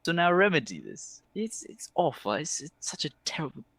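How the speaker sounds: high, hemming and hawing elf; theatrical elf, regretful. Acted. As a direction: break bad news with dramatic regret. Game master voicing the elf.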